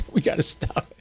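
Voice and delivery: laughing through the words; chuckle, then talk on breathlessly through the laugh. Real, not acted. Joking about PC, laughing.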